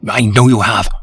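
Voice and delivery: gasped. Acted. Nervous visitor blurts it out to Holmes breathlessly. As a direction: gasp it out, short of breath. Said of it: "our visitor gasped".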